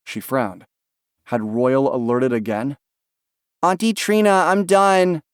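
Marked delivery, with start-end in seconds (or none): 3.6-5.2 put-on high child voice calling from afar. A narrator's reading. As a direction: call out like a little boy. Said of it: "Ben called".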